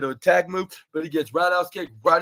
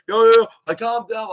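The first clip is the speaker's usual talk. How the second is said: lightly voiced referee stepping in, higher pitch; imitated referee; 7 semitones higher, 6 dB louder than usual. Retelling a referee breaking up wrestlers, imitated intervention. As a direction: mimic someone urgently breaking up a scuffle.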